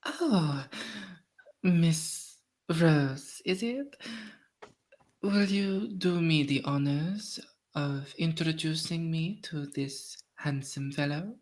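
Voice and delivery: slow, refined society lady; coy, drawn-out formal request with pauses; courtly lady Violette. Acted. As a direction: play a grand lady asking for an introduction.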